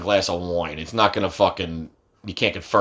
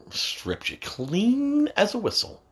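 boastful taunt; 13 semitones higher, 4 dB quieter, slower than usual. Real, not acted. Bragging about basketball steal.

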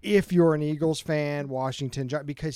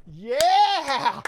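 high, loud gleeful yell; cheering yell; 9 semitones higher, 5 dB louder than usual. Retold or imitated. Fan cheering as Dak goes down, gleeful yell. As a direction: whoop like a rival fan celebrating. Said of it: "you know what I did on Sunday when Dak went down?".

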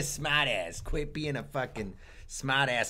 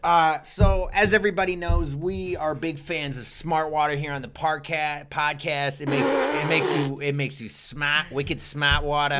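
louder, pitchy sales pitch; fake ad read; 2 semitones higher, 5 dB louder than usual. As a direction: hype up the product like a cheesy ad. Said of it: "I wanna do some fake ad reads".

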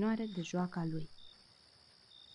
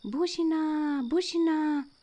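high, loud alarmed cry; a kitten yelling a warning at the top of his voice; shouting; 9 semitones higher, 6 dB louder, slower than usual. A narrator's reading. Kitten shouting to the cricket about the owl, high and loud. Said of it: "Jup începu să strige în gura mare".

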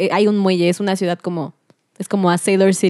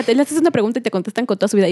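usual speaking voice

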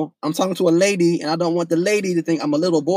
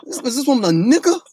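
high, stammering, with a rising question on each line; retold flustered panic; baffled disbelief; 7 semitones higher than usual. Telling friends what he wondered about his date, baffled disbelief.

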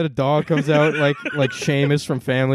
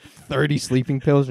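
usual speaking voice

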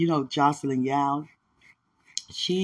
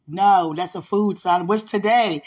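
louder, higher, slow questioning; exasperated mom; 4 semitones higher, 6 dB louder than usual. Retold or imitated. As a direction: press the kid for an answer. Mom quizzing forgetful son.

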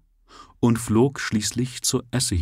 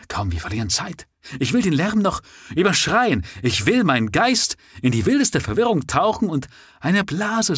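higher, eager and urgent; wild excitement; 7 semitones higher than usual. A narrator's reading. Oberon urges his friend out into the crowd, excited. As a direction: rush to plunge into the uproar. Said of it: "rief Oberon".

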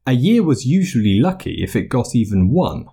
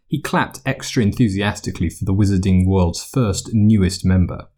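ordinary delivery

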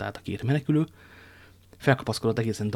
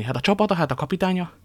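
high, raised-voice questioning; startled protest; 8 semitones higher, 4 dB louder than usual. A narrator's reading. Woman reminding the soldier of his duty, startled protest. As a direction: press both questions in alarmed surprise. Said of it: "kiáltott fel Bonacioni".

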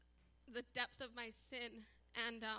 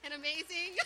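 higher, louder, through a giggle; laughing; 3 semitones higher, 5 dB louder than usual. Real, not acted. Woman testifying about her wedding night, laughing.

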